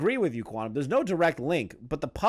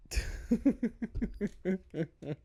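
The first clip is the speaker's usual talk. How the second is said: soft laugh; 5 semitones higher, 6 dB quieter than usual. Real, not acted. Laughing after rereading the quote.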